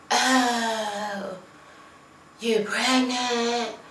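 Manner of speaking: slow, hushed, spaced-out dude voice; mock dumbfounded dude. Vlogger mimics the friend's slow blank reaction. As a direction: play the blank-stare friend, drawn out and soft. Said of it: "he was like".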